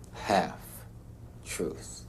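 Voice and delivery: HALF-TRUTH split by a long pause, very soft; mulling it over. Acted. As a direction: turn "Half-truth" over slowly, word by word, quietly. Repeats the term, mulling it over.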